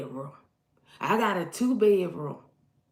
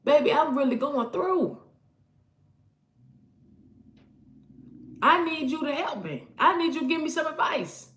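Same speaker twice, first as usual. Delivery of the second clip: imitating a plea to partner; 7 semitones higher, 4 dB louder than usual. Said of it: "Sitting down and communicating".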